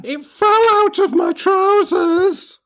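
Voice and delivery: slow squeaky falsetto; slow high falsetto. Pretend. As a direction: drag it out way up high. Joining the falsetto bit.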